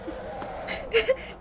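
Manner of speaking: airless high giggle; silent laugh. Real, not acted. Laughing at her own bad Southern accent.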